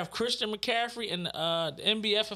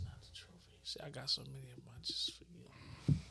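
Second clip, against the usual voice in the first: whispered fake-modest brag; mock whisper; 7 semitones lower, 15 dB quieter than usual. Showing off trophies to friends, in a whisper. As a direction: breathe it like a secret boast.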